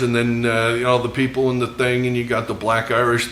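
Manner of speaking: ordinary talk, nothing marked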